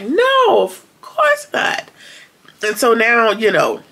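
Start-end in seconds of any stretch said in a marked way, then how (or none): 0.0-1.9 mock squeaky drawn-out no, of course not; high denial. Peter insisting to Daikon he never ran, in a high, slow mock voice. Retold Peter denying running away.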